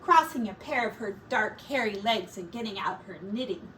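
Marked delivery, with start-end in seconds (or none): none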